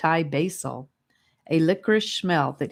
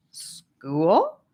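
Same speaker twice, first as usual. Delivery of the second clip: surprised question. A narrator's reading. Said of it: "asked Sephora with raised eyebrows".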